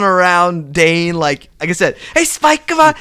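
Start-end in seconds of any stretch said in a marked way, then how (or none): 2.1-2.9 high-pitched excitable pestering; eager little-dog sidekick. Retold or imitated. Wrestler running around his big partner, eager impression. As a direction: imitate a yappy tagalong.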